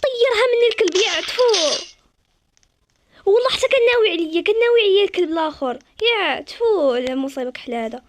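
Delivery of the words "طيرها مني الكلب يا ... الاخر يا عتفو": louder and higher, spitting indignation; angry outburst. Real, not acted. Gamer furious at a rival who stole her kill, loud. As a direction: vent at the player who stole it.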